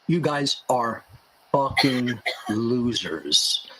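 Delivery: very slow, low, drawn-out words; mock-pleasant. Shown as a demonstration. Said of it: "it's being said so pleasantly".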